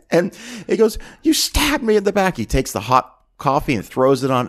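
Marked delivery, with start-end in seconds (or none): 1.2-2.4 high-pitched mock outrage; acted accusation. Producer accusing his assistant before throwing coffee, mock outrage. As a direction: hurl it like a betrayed boss, hammed up. Acting Lee accusing Elliot.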